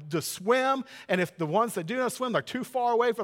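normal talking voice

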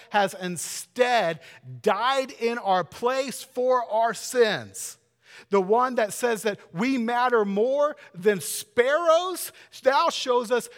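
loud, high, driving sermon climax; preaching at full force. Acted. Pastor proclaiming God's love to congregation, loud preaching. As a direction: preach it out, building and pounding.

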